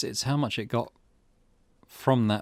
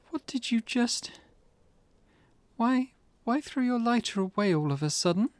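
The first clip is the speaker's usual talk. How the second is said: put-on much higher, startled girl's voice; a young woman gasping at a sudden act; alarmed girl; 13 semitones higher than usual. Girl confronting her companion, alarmed and high.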